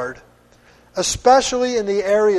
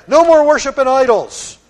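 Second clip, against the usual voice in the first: mock dismissive decree; 5 semitones higher, 6 dB louder than usual. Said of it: "He didn't just say".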